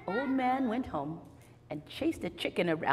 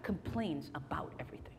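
whisper; 6 semitones lower, 9 dB quieter than usual. Shown as a demonstration. Confiding about Isaac.

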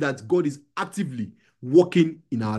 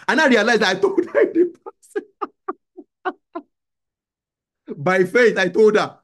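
high, loud, breaking into laughter; laughing storyteller; 6 semitones higher, 4 dB louder than usual. Real, not acted. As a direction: tell it through helpless laughter. Laughing over his own funny story.

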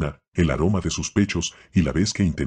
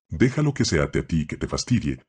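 usual speaking voice

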